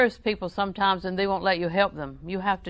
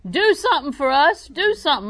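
louder, higher begging cry; urgent plea; 8 semitones higher, 9 dB louder than usual. Retold or imitated. Blind men begging Jesus, loud high plea. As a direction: cry out for help.

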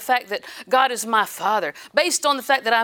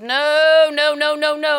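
defiant no; 4 semitones higher, 7 dB louder, slower than usual. Retold or imitated. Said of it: "I said".

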